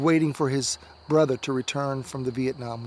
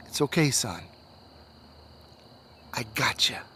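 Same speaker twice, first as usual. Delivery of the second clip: gentle rescuer; 4 semitones lower, 5 dB quieter than usual. Acted. Rescuer finds the delirious boy.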